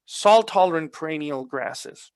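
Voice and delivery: "salt-tolerant" given clear teaching emphasis; stress on salt-tolerant. Shown as a demonstration. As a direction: lean hard on "salt-tolerant" to make the point. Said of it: "I'm em-, emphasizing on the word".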